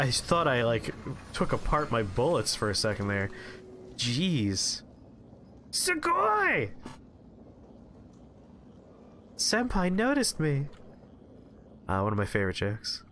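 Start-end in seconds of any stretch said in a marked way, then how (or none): 5.7-10.7 squealing high anime fangirl; excited anime fan. Pretend. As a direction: gush like a starstruck anime fan.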